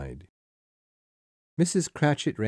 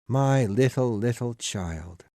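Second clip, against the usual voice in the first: put-on understated, drawn-out grief for a child; grieving cry; 4 semitones higher than usual. A narrator's reading. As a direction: lament as a father, slowing at the end. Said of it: "he cried suddenly".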